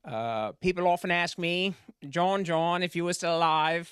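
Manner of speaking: slow, drawn-out presidential impression; Kennedy. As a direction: hold forth like an old-time president, drawn out. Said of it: "Do Your Kennedy".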